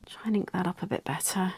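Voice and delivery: soft whispered aside; whispering. Real, not acted. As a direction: murmur it to yourself. Hushed working aside.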